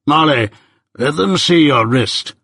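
slow, insistent command; Jaggers, immovably determined. A narrator's reading. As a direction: give the order slowly and firmly. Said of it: "with an immovable determination to show it".

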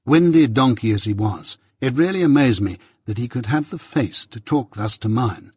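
usual speaking voice

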